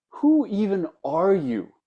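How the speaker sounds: flustered, high, loud and slow outburst; a rattled emperor blurting it out. Retold or imitated. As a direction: caught off guard, raising the voice. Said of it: "in that kind of flustered moment".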